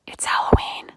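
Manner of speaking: breathy stage whisper with a punch; spooky whisper. Acted. Reading the spooky book's answer, whispered. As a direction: hiss the answer like a secret.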